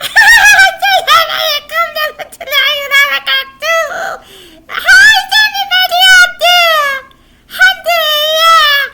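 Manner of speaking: very high, loud squealing puppet; excited puppet greeting. Acted. Puppet boy greets the friends, squealing and loud. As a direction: shriek the greeting with glee.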